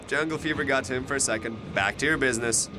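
ordinary delivery

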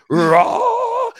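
sudden high, loud mock roar; a hammed-up angry yell to cap the rant. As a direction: bellow like a riled-up old man. Dad's imagined rant ends in roar.

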